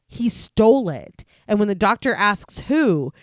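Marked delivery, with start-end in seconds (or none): none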